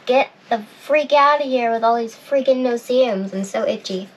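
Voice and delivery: ordinary talk, nothing marked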